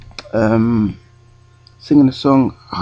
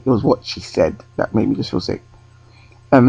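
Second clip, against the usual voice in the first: ordinary talk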